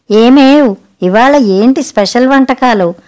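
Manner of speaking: plain reading voice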